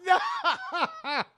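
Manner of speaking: very high, laughing mock wail; grieving wail. Son grieving dead father.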